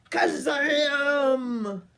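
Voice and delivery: loud, high exclamation of magic; magic burst. A narrator's reading. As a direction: shout it like a spell going off.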